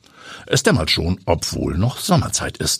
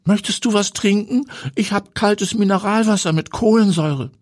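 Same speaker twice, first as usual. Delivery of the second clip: higher woman's voice, polite hostess; Heidi's voice. A narrator's reading. Heidi offers her guest a drink, lightly voiced hostess.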